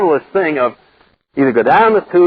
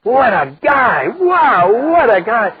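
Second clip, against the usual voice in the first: slow, much higher mock cheer; 8 semitones higher, slower than usual. Imagined crowd cheering as he goes down, slow high shout. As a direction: hoot it out like an admiring crowd. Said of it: "imagine everybody just going".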